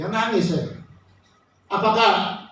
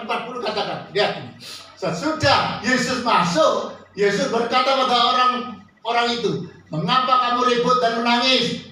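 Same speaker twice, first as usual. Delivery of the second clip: raised, declaimed Bible reading; scripture reading; 5 semitones higher than usual. Real, not acted. Preacher reads Markus to congregation, then cues them, declaiming. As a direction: read it out high for everyone to follow.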